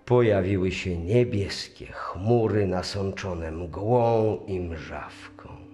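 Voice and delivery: usual speaking voice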